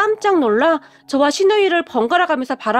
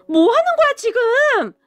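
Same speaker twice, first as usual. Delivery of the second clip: high, startled yell; shouting; 10 semitones higher than usual. Retold or imitated. Husband yelling at sister after water thrown, high. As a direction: yell it out in shock. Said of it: "이라며 소리를 질렀어요".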